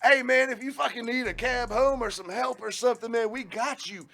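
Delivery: high-pitched mock holler; drunken yelling. Drunk manager calling out from the front bar, yelling. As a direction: holler big-hearted promises like a drunk boss. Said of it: "hear him in the kitchen just yell".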